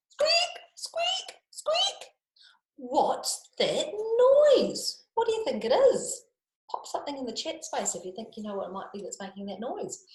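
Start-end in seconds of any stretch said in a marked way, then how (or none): 0.1-2.1 very high whispered squeaks; high, whispered mouse squeak; tiny squeak. Acted. Mystery squeak. 2.8-6.2 high, drawn-out wonder; high, slow curious question. A narrator's reading. Puzzled question to children.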